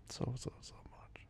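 soft, slow whisper; tender whisper. Real, not acted. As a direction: breathe it lovingly.

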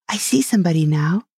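quick, lightly excited discovery; mild exclamation as Alice spots someone; exclaiming. A narrator's reading. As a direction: call out the sighting briskly, without shouting. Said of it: "she exclaimed at last".

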